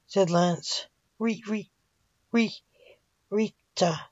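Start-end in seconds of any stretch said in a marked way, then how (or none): 1.2-4.0 softer, broken stammer before the name; stammering through tears. A narrator's reading. Father naming the victim to his son, tearful stammer. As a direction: trip over the name through crying. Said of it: "Blake said through his tears".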